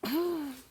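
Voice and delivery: short real laugh. Real, not acted. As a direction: burst out laughing. Laughs after swallowing.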